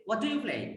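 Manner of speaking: "what do you play?" said softly, the voice falling through "do"; soft falling question. Shown as a demonstration. Teacher models for students, soft falling tune. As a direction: keep "do" small and let "what do you play?" drop. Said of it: "softly".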